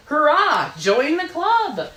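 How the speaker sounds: high, loud, slow cheer of welcome; cheerful shout. Acted. Wildebeest welcoming the hyena, a high loud cheer. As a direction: cheer out to the newcomer. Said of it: "the wildebeest shouted".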